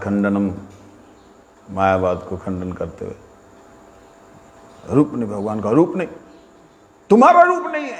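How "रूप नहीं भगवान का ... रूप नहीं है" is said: rising to a high, loud preaching cry; imitating guru's loud preaching. Guru preaching to the crowd, a high loud cry. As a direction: thunder it out like the guru at katha. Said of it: "गुरु जी बड़ी ज़ोर-ज़ोर से कथा कर रहे हैं".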